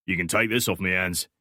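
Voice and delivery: lightly voiced Cockney stranger; offhand Cockney handover; Cockney accent. A narrator's reading. Stranger handing Alex a phone, offhand Cockney accent. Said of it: "thick Cockney accent".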